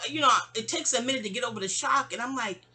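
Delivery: normal talking voice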